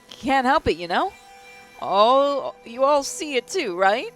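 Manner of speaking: can barely contain the excitement; shaky excited voice. Acted. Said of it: "I can't stop smiling and shaking".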